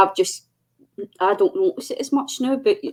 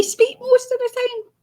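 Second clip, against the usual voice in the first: mock indignant squeak. Joking self-defence, squeaky high.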